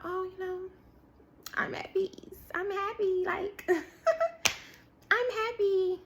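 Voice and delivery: high, light, put-on contentment; mock an airy claim of being fine, with a little laugh; faked happiness. Someone limited insisting they have peace, faked happiness. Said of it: "You're not happy".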